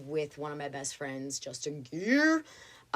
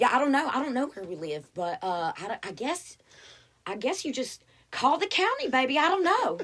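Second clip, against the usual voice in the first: put-on higher, louder, laughing Southern gal calling her baby; laughing operator; 7 semitones higher, 5 dB louder than usual. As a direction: giggle through it, sweetly unsure. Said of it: "She was like".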